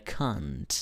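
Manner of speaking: British "can't" with a broad ah; "can't" with a long open a, as in "father"; British pronunciation. Shown as a demonstration. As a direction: say "can't" as "kahnt", British style. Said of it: "en la pronunciación británica".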